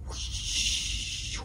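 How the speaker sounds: long, airy whoosh; soft rush of air, drawn out; whoosh of a wing. Shown as a demonstration. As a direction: imitate a bird's wing cutting the air. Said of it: "I wonder what that sound would".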